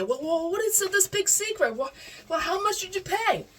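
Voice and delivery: high, prying mom voice, mock; nosy mom. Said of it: "She kept grilling me".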